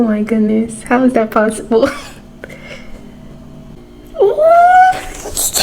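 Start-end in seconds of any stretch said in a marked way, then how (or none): 4.1-5.6 very high, long drawn-out what; stunned disbelief. Real, not acted. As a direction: stretch it out in total disbelief. Stunned by the book again.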